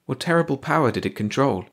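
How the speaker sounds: ordinary delivery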